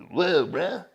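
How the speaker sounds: put-on dude-bro amazement; awestruck bro. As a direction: play a bro having his mind blown. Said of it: "as a bruh, you're like".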